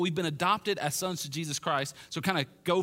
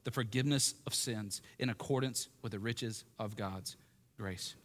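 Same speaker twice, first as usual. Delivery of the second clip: low, soft and unhurried prayer; 7 semitones lower, 8 dB quieter than usual. Real, not acted. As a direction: pray quietly to the Father, leaving pauses. Closes a reading in prayer.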